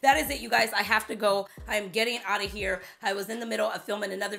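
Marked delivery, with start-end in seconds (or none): none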